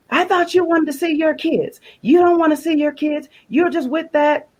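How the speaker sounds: imitating a girlfriend's high, angry taunt; accusing girlfriend. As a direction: throw it at his back like a jealous girlfriend. Girlfriend chasing him, accusing.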